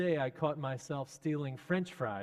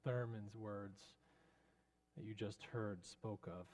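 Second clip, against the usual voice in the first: usual speaking voice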